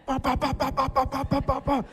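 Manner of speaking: high, rapid-fire imitated punches; high machine-gun burst of mimed blows; rapid punch noises. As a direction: fire off even, staccato punch sounds. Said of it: "swinging as fast as I can".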